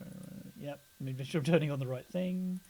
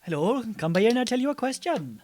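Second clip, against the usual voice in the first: lilting, higher-pitched comic Welsh; Welsh accent; 6 semitones higher, 4 dB louder than usual. Pretend. Co-host spoofing a South Wales TV show, comic Welsh accent. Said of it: "At least your accent didn't go Jamaican".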